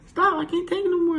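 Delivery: put-on very high squealing little girl; high-pitched little-kid begging. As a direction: plead in a tiny high child voice. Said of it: "I got this little ass girl voice".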